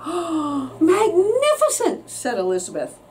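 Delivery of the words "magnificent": high, very slow drawn-out praise; mock amazed. A narrator's reading. Princess flattering the tired dragon, slow high fake awe.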